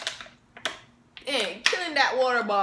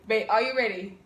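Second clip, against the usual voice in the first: usual speaking voice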